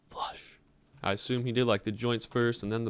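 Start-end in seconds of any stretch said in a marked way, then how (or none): 0.1-0.6 breathy flush noise; whispered flush. Retold or imitated. Teen imitating the toilet flushing, a breathy flush noise. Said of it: "I just hear".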